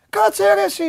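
high-pitched protest, retold; mock high-pitched confrontation; imitating indignant player. Acting out the player's reaction.

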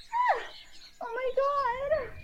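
very high, soft, as if far off; thin scream from another floor; distant scream. A narrator's reading. Heard from downstairs in alarm, a distant scream.

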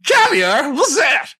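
high, exaggerated puzzled Southern voice; mock Southern guest. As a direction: ham up the clueless guest. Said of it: "is that your accent or is that their accent".

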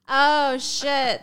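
ordinary delivery

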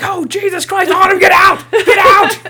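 high, loud frantic yell; hammed-up panicked high yell; mock panicked shout. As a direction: scream for Autumn to run. Wheelers in the room.

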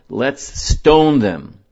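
retelling how the angry congregation cried out; angry mob cry. Retold or imitated. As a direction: shout it as the raging crowd. Congregation's cry to stone the spies.

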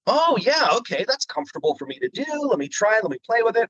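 high, eager self-talk; light up at a new idea; excited. Retold or imitated. Hearing Frog Lady, excited high self-talk.

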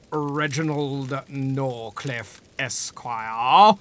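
slow, low, grand gentleman voice; posh gentleman voice. Acted. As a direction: announce himself like a dandy. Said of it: "I am already playing roguish theatrical upstart investor".

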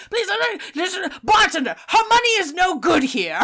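high-pitched, pushy, over-eager patron; insistent Jeffrey. Retold or imitated. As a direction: wave off her refusal with showy generosity. Acts Jeffrey insisting on buying drink.

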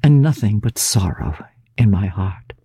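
ordinary delivery, nothing marked

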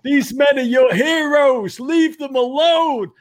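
high, loud, drawn-out scolding shout; Mike Ditka. Retold or imitated. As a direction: holler slowly at pestering kids, mimicking. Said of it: "was his words of welcome".

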